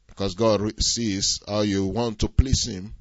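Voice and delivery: usual speaking voice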